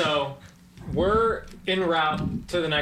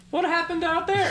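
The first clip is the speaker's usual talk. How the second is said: much higher puzzled call from the bathroom; confused call; 12 semitones higher than usual. Retold or imitated. Guy in the bathroom calling out to friends, puzzled and high. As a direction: ask it high and baffled through a wall. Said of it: "I'm like".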